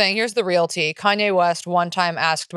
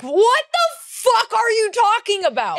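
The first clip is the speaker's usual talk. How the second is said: incredulous; 14 semitones higher than usual. Real, not acted. Exasperated reaction to conspiracy theory.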